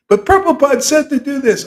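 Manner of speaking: mocking a ritual follower's excuse; mock objector. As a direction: squeak it out like a defensive follower.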